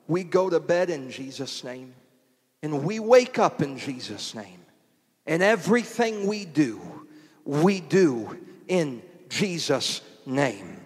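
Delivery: usual speaking voice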